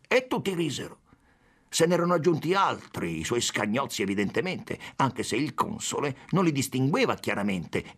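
usual speaking voice

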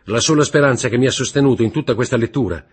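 fast, high, loud outpouring; desperate rush. A narrator's reading. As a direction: spill it all out in one anguished breath. Franz despairs, rushing on.

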